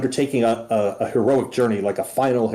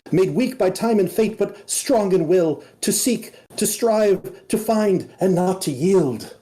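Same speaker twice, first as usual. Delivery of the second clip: high, solemn recited verse; butterfly reciting; 6 semitones higher than usual. Acted. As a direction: intone the old poem like a tiny spirit. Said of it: "you hear it say".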